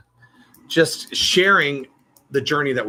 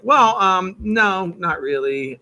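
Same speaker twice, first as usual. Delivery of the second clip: hesitant reply; 7 semitones higher, 4 dB louder, much slower than usual. Retold or imitated. Retelling own hesitant reply.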